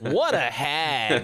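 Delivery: slow put-on cartoon voice, laughing; cartoon sidekick quip. Retelling a favorite Kingdom Hearts cutscene line in a slow cartoon voice. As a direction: drawl the insult like a Disney sidekick. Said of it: "Maleficent cackling and disappearing, and then".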